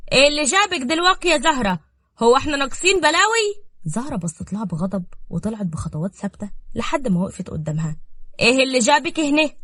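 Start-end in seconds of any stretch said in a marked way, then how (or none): 0.1-3.6 raised, higher scolding voice; angry yelling. A narrator's reading. Roqaya yelling at Zahra from atop the stairs. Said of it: "وبتزعق بصوت عالي". 8.4-9.5 higher, louder demand; angry challenge. Acted. Roqaya confronting Zahra on the stairs, angry.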